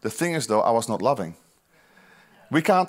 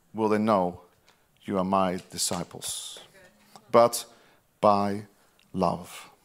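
preaching at full force; 8 semitones higher, 3 dB louder, slower than usual. Acted. Pastor preaching the mark of disciples, rising then hushed. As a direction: build the call, then let it drop to a hush. Preacher voicing Jesus' words.

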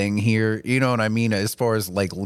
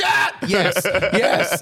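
high, relieved agreement; relieved high yes; 8 semitones higher, much slower than usual. Retold or imitated. Tattoo artist admits to friend he feels awful, high and relieved. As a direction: answer high with happy relief.

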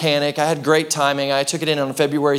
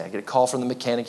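ordinary talk, nothing marked

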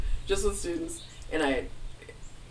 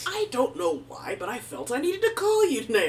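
imitated dad; 7 semitones higher, 3 dB louder than usual. Father calling out of the blue, higher-pitched imitated voice. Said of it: "He talks like that".

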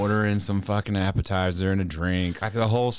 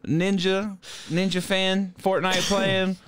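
hammed-up roast, voice pushed high; 11 semitones higher, slower than usual. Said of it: "He goes".

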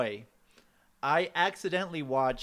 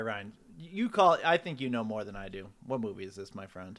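deep host voice; 5 semitones lower than usual. Acted. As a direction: lean into a low mock quizmaster.

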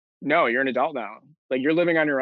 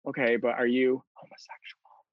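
hushed whisper of a sensitive question; 5 dB quieter than usual. Retold or imitated. Mother asking her son in the empty kitchen, whispering. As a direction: breathe it out, barely voiced. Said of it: "And she whispers it".

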